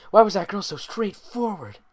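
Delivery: usual speaking voice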